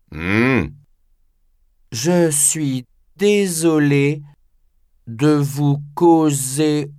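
put-on slow, drowsy old man; sleepy voice. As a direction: drift off as you speak, sleepy. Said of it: "dit Nakata d'une voix ensommeillée".

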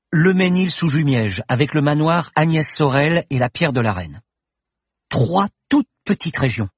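rushing her discovery out in a high, eager voice; student excitedly explaining her find to the professor. A narrator's reading. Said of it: "Paloma bafouilla, excitée".

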